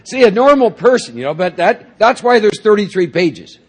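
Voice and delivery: high, loud, laughing delivery of the punchline. Real, not acted.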